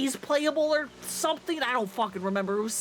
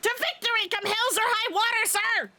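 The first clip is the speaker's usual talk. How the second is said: much higher, a proud battle cry; shouted with conviction; 9 semitones higher than usual. Acted. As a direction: bellow the creed like you mean it. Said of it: "say it like you mean it".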